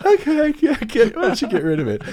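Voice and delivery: very high, loud, goofy agreement; imitating a giddy gas-addled patient; drugged-up voice. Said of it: "in my stupid drugged up head".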